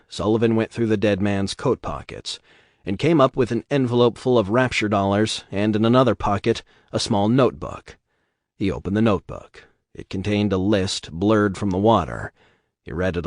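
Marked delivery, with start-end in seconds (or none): none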